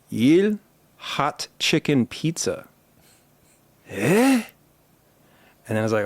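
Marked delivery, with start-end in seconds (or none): 0.1-2.7 put-on slow, careful Korean accent. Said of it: "leaned really hard into my best Korean accent". 3.9-4.4 high, baffled "eh?"; like you can't understand a word; puzzled "eh". Retold or imitated. Counter guy confused again.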